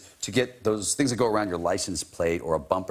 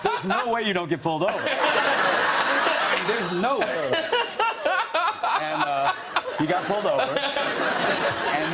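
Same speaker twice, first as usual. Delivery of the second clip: much higher, laughing through the words; giggling while telling it; 13 semitones higher than usual. Real, not acted. Telling the host about the bumper sticker prank, laughing high.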